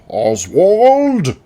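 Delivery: a loud, very high call across the house; sharp, high, loud summons; annoyed shout. Acted. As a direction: yell the name from another room, annoyed. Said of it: "I hear someone shout".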